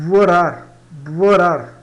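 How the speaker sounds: "vorar" mais alto, com V inicial; B espanhol como V. Shown as a demonstration. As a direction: diga "vorar" forte, B soando como V. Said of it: "Mais ou menos assim". ("vorar" com B inicial próximo de V)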